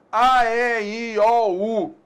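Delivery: "A, E, I, O, U" as long open oral vowels. Shown as a demonstration. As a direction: chant "A, E, I, O, U" evenly, through the mouth. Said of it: "as vogais chamadas orais".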